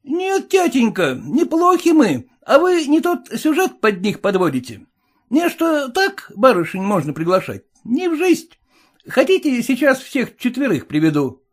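high, brash and boastful; cocky young son. Acted. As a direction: boast like a cheeky young rascal.

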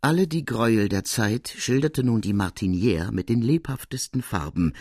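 usual speaking voice

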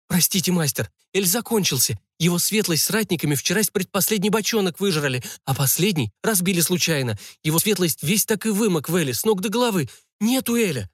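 high, anxious servant's excuse; apologetic kitchen boy. Acted. Kitchen boy explains spilled ale to the cook, high apologetic voice.

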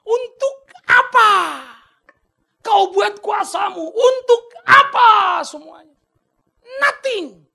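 very high, loud, slow crying shout to God; anguished scream. Acted. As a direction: drag each word out in a desperate scream at heaven. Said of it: "dia teriak".

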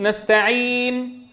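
"نستعين" with the lip rounding coming too late after the نون; slow "نستعين", pause, then round the lips late; late rounding, wrong. Shown as a demonstration. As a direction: show the error of a delayed rounding. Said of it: "ومن الخطأ أن تتأخر بالضم".

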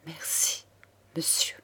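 near-whispered, broken thanks; stammered thanks. A narrator's reading. Count thanks the man who forgave him, stammering. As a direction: falter it out almost without voice. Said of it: "balbutia le comte".

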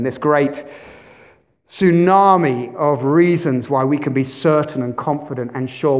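usual speaking voice

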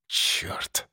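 whispered, hissed curse; шипящее ругательство. A narrator's reading. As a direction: hiss it through the teeth. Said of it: "прошипел я".

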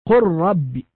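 قل رب said "qurrabb", the lam merged into a doubled ra; lam into ra demo. Shown as a demonstration. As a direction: recite قل رب with the lam absorbed by a doubled ra. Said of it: "اللام أدغمت في الراء". (قل رب بإدغام اللام في الراء)

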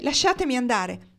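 high, loud cry to be released; shouted demand. A narrator's reading. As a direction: shout it in protest. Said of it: "gridò lei".

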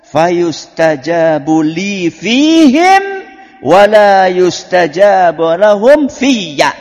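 slow, loud chanted Arabic; loud slow recited Arabic; loud recitation. Acted. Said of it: "Nabi ... menenangkan hatinya Aisyah".

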